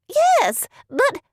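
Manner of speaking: high, loud cut-in; shrill interruption. A narrator's reading. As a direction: jump in very high. Miss Bingley interrupts.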